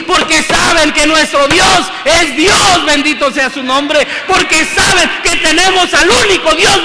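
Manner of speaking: rising, shouted sermon with music behind; fiery preaching. Acted. Preacher proclaiming the living God to the church, shouting. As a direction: preach it hard to the congregation.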